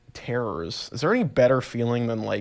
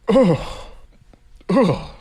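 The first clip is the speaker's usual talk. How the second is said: disgusted ugh; 8 semitones higher, 6 dB louder than usual. Real, not acted. Disgust at imagined leech.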